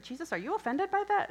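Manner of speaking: high, loud, quick question; retold thought, rising and hurried; playful check-in. Acts out asking Jesus, amused.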